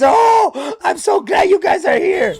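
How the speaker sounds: a grouch suddenly gushing with joy; a hint of a gruff bear gushing; overjoyed grump.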